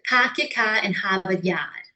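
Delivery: R-dropping Boston accent; broad "ah" Boston vowels. Shown as a demonstration. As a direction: drop the Rs like a Bostonian. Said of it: "like Boston".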